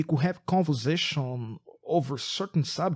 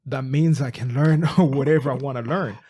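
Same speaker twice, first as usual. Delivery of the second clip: laughing excitedly; 8 dB louder than usual. Retold or imitated. Excited realization, laughing mid-quote.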